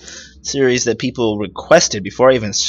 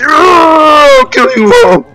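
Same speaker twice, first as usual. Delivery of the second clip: high, loud playful yell; mock battle cry; 7 dB louder, slower than usual. Gamer charges at the bad guys, yelling a mock battle cry. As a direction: roar a hammed-up threat at full pitch.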